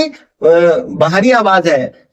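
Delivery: usual speaking voice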